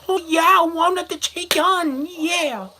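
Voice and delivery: high, hammed-up cries of passion; mock sexual cries. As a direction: parody the yell as bedroom ecstasy. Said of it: "when they're having sex".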